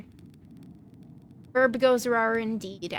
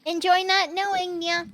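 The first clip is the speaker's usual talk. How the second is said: put-on cutesy baby talk; 6 semitones higher than usual. Shown as a demonstration. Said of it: "Uwu voice".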